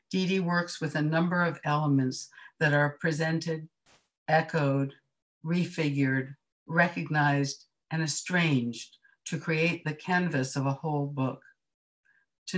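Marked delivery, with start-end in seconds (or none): none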